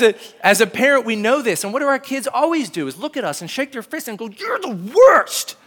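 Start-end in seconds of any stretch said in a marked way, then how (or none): 4.3-5.6 put-on drawn-out high childish "you're the worst"; whiny kid. Kid protesting to his parent, slow and whiny. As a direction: mimic a sulking kid shaking his fist. Kids shaking fist at parent.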